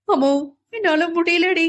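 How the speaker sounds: high, loud tearful wail; sobbing to his friend; wailing cry. A narrator's reading. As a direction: cry out in despair. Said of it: "கதறி கதறி அழுதான்".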